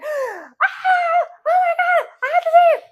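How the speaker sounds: very high-pitched excited squeal; re-enacted ecstatic shriek; retold fangirl scream. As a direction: squeal like an overexcited superfan. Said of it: "screaming from the entrance".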